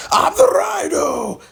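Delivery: put-on high, loud, thick Russian accent yell, slow; Russian accent shout. Paul Giamatti's Rhino in a thick Russian accent, shouting. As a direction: bellow it like a Russian brute. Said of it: "with the super thick Russian accent".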